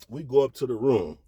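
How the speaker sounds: ordinary talk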